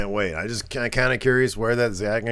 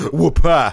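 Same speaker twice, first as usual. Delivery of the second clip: mock wrestler whoop; 4 semitones higher, 7 dB louder than usual. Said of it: "And Steamboat goes".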